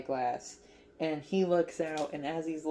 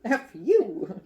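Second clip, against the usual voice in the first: higher, louder playful outburst; jokey burst of outrage; mock fired-up; 7 semitones higher, 7 dB louder than usual. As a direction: imitate an angry yell, half laughing. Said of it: "now he's fired up".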